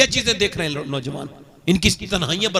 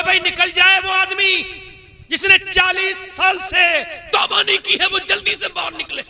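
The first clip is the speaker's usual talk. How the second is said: calling out to the crowd; 11 semitones higher than usual. Acted. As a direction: call the sinner out before everyone. Moses calls out to crowd. (भीड़ को ऊँची आवाज़ में पुकारना)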